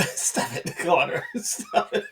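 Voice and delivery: helpless giggling through the words; laughing protest. Real, not acted. Player tells Connor off at the table, laughing protest. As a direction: try to scold but keep laughing.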